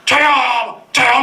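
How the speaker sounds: high, loud classroom cry; eager shout. Retold or imitated. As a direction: call out to be picked. Kids raising hands eagerly. (손 들며 외치는 높은 목소리)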